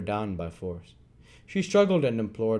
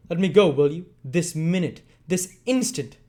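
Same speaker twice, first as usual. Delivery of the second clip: louder, higher insistent plea; loud demand; 8 semitones higher, 7 dB louder than usual. Acted. Nancy demanding Sikes let her go, loud. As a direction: demand it, each word harder.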